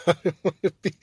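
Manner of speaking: laughing through the words. Real, not acted. Joking about becoming Alex Jones, laughing. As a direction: chuckle while saying it.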